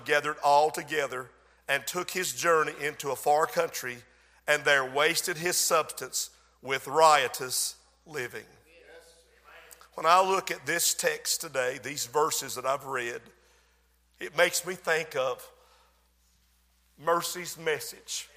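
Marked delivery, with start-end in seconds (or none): none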